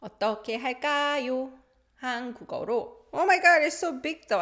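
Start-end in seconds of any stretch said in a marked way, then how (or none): 3.1-4.4 loud, high-pitched dismay; acted oh-my-God surprise; shocked exclamation. Acting out shock at oversized clothes.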